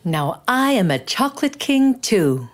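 proud declaration. Acted. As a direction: declare it to everyone, chest out. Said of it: "Benjamin would proudly declare".

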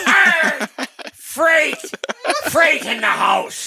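high hammed-up pirate shout, slowing and laughing; pirate cry. Pirate firing on the crowd, hammed-up pirate yell. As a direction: bellow it like a cartoon pirate.

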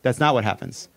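ordinary delivery

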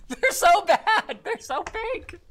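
high hammed-up weepy whine; overdone fake tears; mock crying. Mocking YouTuber apologies.

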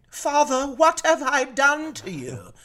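high, loud, slowed cry to heaven; anguished plea. Retold or imitated. Recalling the tattoo pain, crying out to Father. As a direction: wail it like a martyr. Retold thought during painful tattoo.

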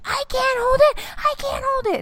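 put-on high, desperate child wail; screaming kid. Mom imitating her son in the car, high and desperate. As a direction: plead like a toddler about to burst. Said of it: "just starts screaming".

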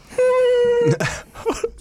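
cracking up in disbelief; shrieking laugh. Real, not acted. Retelling a terrible pickup line, shrieking laugh.

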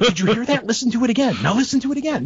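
high, hammed-up breathless ghost-hunter hype; mock ghost hunter. Mocking ghost-hunting shows replaying a clip, hammed-up excited.

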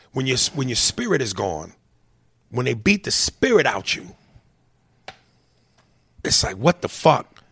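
ordinary talk, nothing marked

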